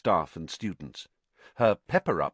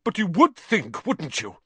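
higher, louder sudden burst; erupting outburst; 9 semitones higher, 6 dB louder than usual. A narrator's reading. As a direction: explode with bitterness. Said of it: "he erupted suddenly".

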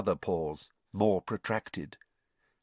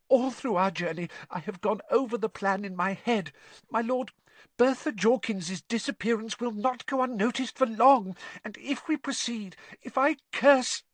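high, urgent, squeaky argument; panicked; 13 semitones higher than usual. A narrator's reading. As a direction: plead your plan before you are cut off. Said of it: "panic in his voice now".

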